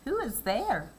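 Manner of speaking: put-on high old grandmother voice; old woman voice. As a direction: call out like a frail old lady. Grandmother calls from bed.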